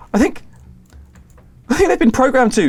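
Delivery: high, loud panicked guess; frightened shout. Acted. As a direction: call it out over chaos. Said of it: "another one shouts".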